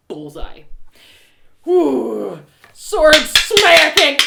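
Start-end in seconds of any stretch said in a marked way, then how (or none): 1.7-4.2 slow, loud mouth sound effects; sword sound effects. Acted. Narrator voicing the sword fight, loud sound effects. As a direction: act out the clashing swords.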